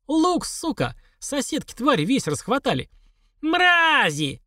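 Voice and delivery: high-pitched indignant cursing; outraged old woman. A narrator's reading. Old woman at the empty onion shelf, outraged. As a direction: curse the neighbours in a high, strained voice.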